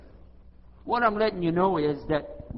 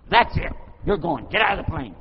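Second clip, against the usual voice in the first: loud acted order to get out; angry outburst; 8 dB louder than usual. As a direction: throw them out in a loud, angry voice. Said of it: "His emotions have taken over".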